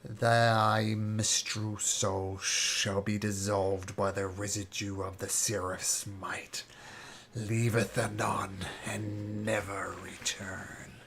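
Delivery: put-on slow, archaic old-tongue voice, half whispered; eerie archaic speech, drawn out slowly; strange ancient accent. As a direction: intone it like an ancient being from another age. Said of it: "he says in this very strange accent".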